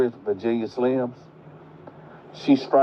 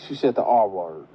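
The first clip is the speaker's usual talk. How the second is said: normal talking voice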